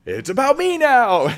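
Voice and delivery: high, loud and slow smug voice; mock selfish. Pastor mocking the self-centered listener, high smug gloat. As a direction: gloat that it's all about you. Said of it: "And I redirect".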